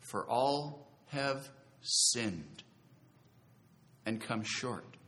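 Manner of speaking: very slow, hushed scripture reading; solemn verse reading. Retold or imitated. Friend reads him the convicting verse, slow and solemn.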